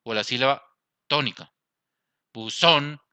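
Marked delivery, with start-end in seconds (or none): 2.3-3.0 "Buzón" with a strong final "zón"; "Buzón", emphatic second syllable; stress on last syllable. Shown as a demonstration. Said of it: "En la última".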